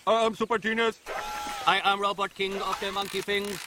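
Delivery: stiff robotic toy announcer. Pretend. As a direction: speak like a clunky toy robot. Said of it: "Time to robot".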